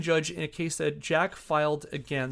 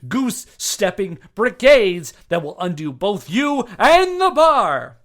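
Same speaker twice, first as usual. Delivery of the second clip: Jack Thompson's tirade; 7 dB louder than usual. Retold or imitated. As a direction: thunder it out like a lawyer facing disbarment. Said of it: "back to Jack".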